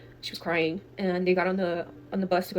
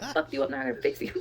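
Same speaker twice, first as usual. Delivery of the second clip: quick, higher, laughing through it; laughing retelling; 6 semitones higher, faster than usual. Retold or imitated. Retelling tia's joke to a friend, laughing. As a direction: relish the punchline while giggling.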